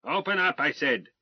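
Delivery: the colonel pounding on the door with his pistol butt; barked order. Acted. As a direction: insist at full volume.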